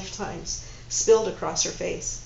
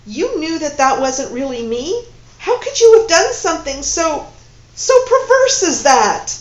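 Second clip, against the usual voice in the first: loud, rising higher to perverse; furious accusation; 7 semitones higher, 11 dB louder than usual. Acted. Woman accusing her lover of betrayal, building fury. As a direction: build your outrage to a peak.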